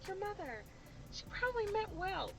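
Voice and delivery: high, soft, syrupy mock voice; high, airy sarcastic imitation of the audience; mocking viewers. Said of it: "I know y'all sitting here thinking".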